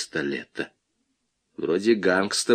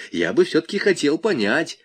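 mildly peevish, pitched higher; querulous insistence, voice raised in pitch; 6 semitones higher than usual. A narrator's reading. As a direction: press the point a bit testily. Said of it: "сказал Малянов сварливо".

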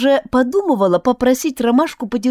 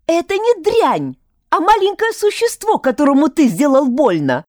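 angry scolding; 7 semitones higher than usual. Acted. Heroine scolds Albert furiously.

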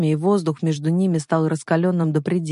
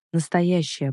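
ordinary delivery

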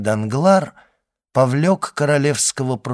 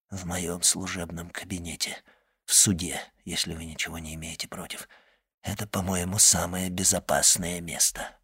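tense courtroom whisper; 5 semitones lower, 9 dB quieter than usual. Acted. Secret exchange continues in whisper.

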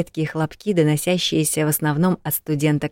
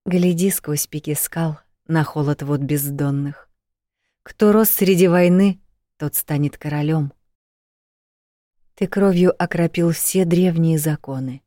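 ballad recitation. Acted.

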